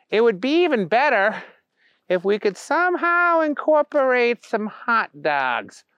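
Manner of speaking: high, drawn-out dim suggestion; musing Booger. A narrator's reading. Booger suggesting an Easter improvement, high and dreamy. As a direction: muse slowly in a high voice.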